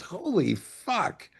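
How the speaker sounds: slow, hushed, awed curse; stunned disbelief. Retold or imitated. Director recalling De Niro's yes, slow awed whisper. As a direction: say it slowly, like it cannot be true.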